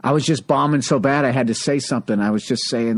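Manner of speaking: normal talking voice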